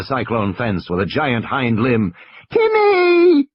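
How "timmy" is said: put-on high, loud scream of a child; terrified scream. High, loud panicked child cry. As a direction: Lex screams for her brother in terror. Lex screams as Rex crashes through.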